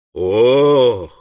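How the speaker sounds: long, heavy 'oh'; drawn-out astonished cry; startled exclamation. Acted. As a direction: exclaim as if stepping back amazed. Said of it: "воскликнул Уордль".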